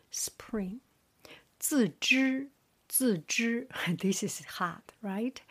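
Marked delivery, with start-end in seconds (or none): 1.6-3.7 自知 with 自 falling, 知 held high and level; fourth-tone demo. Shown as a demonstration. Teacher starts from fourth tones, 自知 falling then high level. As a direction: drop 自, then sustain 知 high. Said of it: "starting from fourth tones".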